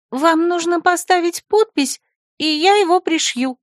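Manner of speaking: put-on high girlish voice; silly secretary. Secretary asks the rector to sign, high and girlish. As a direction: chirp it innocently. Lulu's voice.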